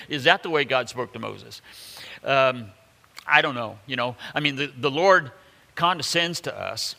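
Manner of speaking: ordinary talk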